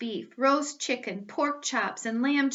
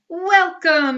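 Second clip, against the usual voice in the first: much higher, much louder beaming greeting; booming welcome; 8 semitones higher, 13 dB louder than usual. Acted. Dumbledore welcomes the students, booming and beaming. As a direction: greet the whole school grandly.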